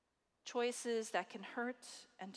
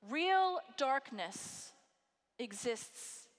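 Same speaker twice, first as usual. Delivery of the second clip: slow; 2 semitones higher, much slower than usual. Acted. Preacher slows for weight.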